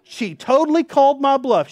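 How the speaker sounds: spring the punchline with glee; high, loud, delighted; excited punchline. Real, not acted. Punchline of wife calling bluff.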